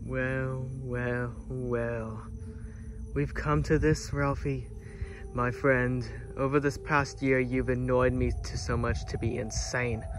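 low, soft gloating villain voice; menacing villain. Acted. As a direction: speak like a villain savoring victory. Villain monologue to his foe.

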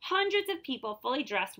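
usual speaking voice

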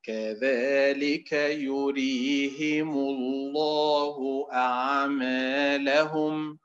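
slow, drawn-out chanted recitation; Quran recitation. Shown as a demonstration. Teacher chanting a verse for students, slow recitation. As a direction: intone the verse slowly, tajweed style.

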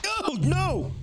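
high, loud dismayed cry; dismayed yelp. Real, not acted. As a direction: yelp as things go wrong. Player character gets hit.

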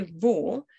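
"wo" said as "vo"; labiodental W. Shown as a demonstration. Teacher showing learners the German W, "wo" with a v sound. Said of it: "upper teeth need to touch your lower lip".

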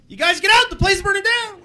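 high-pitched put-on alarm; comic cry of warning, held high throughout; urgent warning character. Shown as a demonstration. Guy warning everyone of a fire, high urgent cry. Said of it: "doesn't know how to match his face".

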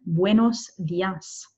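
"buenos dias" drawn out slowly; teacher's slow repeat of "buenos dias"; slow model pronunciation. Shown as a demonstration. Teacher repeats phrase slowly for learners.

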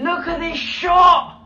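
high, loud, slow shout of awe; awed shout. Real, not acted. Fan stunned by a battle shot, shouting in awe. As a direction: belt it out in amazement.

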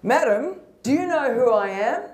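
lightly voiced pompous passenger; high-horse passenger. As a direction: play the self-important customer. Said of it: "he got on his high horse".

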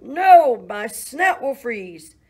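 very loud, high squealed no; squealing pig. Acted. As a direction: shriek the refusal like a pig. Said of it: "The pig squealed".

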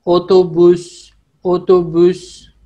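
ओतोबुस said slowly, each o round and clear; slow teacher model of ओतोबुस; Turkish word demo. Shown as a demonstration. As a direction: stretch ओतोबुस out, o-to-bus. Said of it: "ये English वाला ओ उसी की तरह ही pronounce होता है".

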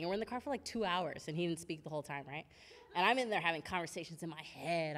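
ordinary talk

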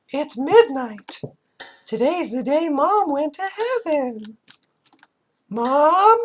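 put-on squeaky child voice for Aya; creepy Mickey Mouse. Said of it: "I sound like a very creepy Mickey Mouse".

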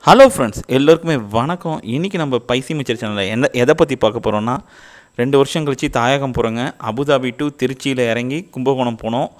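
muffled behind surgical mask; speaking behind face shield; through a face mask. Real, not acted. Seen 0: Surgical mask covers mouth throughout; face shield added from 1.1s.

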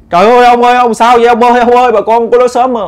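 high, loud panicked wailing; wife screaming for help as husband flails. Retold or imitated. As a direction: shriek for help in terror. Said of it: "bác Tư gái la làng".

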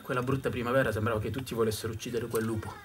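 plain reading voice

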